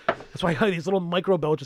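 ordinary talk, nothing marked

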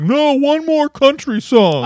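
put-on high, slow whiny drunk plea; drunk DJ pleading. Drunk friend in the DJ booth refusing to leave, high whiny plea. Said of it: "he's like".

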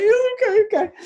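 high, small mock yes, imitating his younger self; meek agreement. Told to get over himself, meekly agreeing in a high squeak. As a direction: squeak a cowed okay after a telling-off. Meekly giving in to blunt director.

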